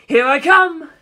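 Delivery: high, loud battle cry; yell. Acted. Funny charging at Kaz, loud yell. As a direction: charge in shouting. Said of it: "Funny yelled".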